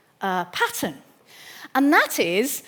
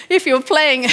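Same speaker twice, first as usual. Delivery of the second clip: louder, through a real laugh; amused laughter; 4 semitones higher, 4 dB louder than usual. Real, not acted. Lecturer laughing at her own overdone Shakespeare, amused. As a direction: let a chuckle break round the words.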